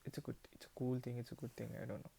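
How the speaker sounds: usual speaking voice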